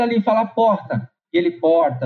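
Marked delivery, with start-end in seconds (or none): 1.5-2.1 put-on São Paulo accent. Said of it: "O jeitão paulista". (Porta com sotaque paulista, r leve)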